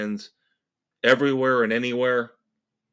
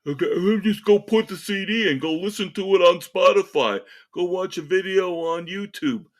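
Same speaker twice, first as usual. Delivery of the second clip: higher, louder mocking voice; mocking idiots; 7 semitones higher, 5 dB louder than usual. Host mimicking idiots who say use Spotify, mockingly. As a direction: imitate the clueless crowd, high and rushed. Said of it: "the idiots out there who are saying".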